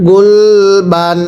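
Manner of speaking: গুলবান stretched very slowly, ending on a dental n; teacher modelling গুলবান slowly for students; slow drawn-out reading. Shown as a demonstration. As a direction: read গুলবান syllable by syllable, slowly, with a clear final n. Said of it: "আমার সাথে পড়েন".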